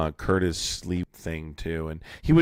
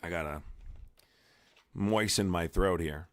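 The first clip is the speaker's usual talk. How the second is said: ordinary talk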